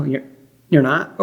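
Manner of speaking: ordinary talk, nothing marked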